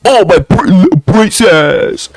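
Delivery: lightly voiced doting dad, sing-song; mock a father cooing at his daughter; a hint of a cooing father. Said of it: "especially the fathers".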